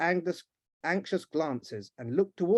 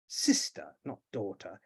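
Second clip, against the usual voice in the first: ordinary delivery, nothing marked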